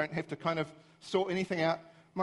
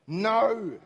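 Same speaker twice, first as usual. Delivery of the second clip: exasperated; 3 semitones higher, 5 dB louder than usual. Retold or imitated. Rejects Siri's garbled message again.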